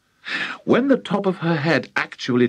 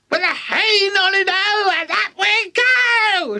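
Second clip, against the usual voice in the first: shouting crone. Acted. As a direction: chant it like a giddy old witch. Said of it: "she shouted".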